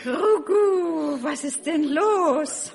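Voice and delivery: cooing dove. Acted. Dove from next door asks Max, high slow coo. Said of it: "fragte plötzlich die Taube".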